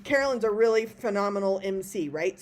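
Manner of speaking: ordinary delivery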